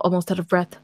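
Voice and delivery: usual speaking voice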